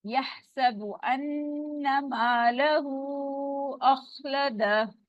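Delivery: slow chanted tajweed reading; Quranic recitation. Shown as a demonstration. Teacher reciting the verse for students, tajweed chant. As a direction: recite slowly with long vowels.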